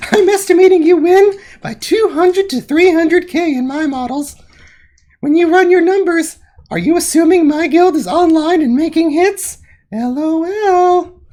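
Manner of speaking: high, loud smug squeaky voice; mock Celeste voice. Streamer voicing Celeste's smug chat in a high loud mock voice. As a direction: lay on the smugness up high.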